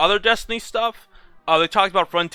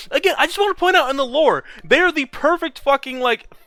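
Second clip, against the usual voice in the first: excited gushing; 7 semitones higher than usual. Real, not acted. Gushing about the Vex.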